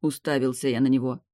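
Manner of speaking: usual speaking voice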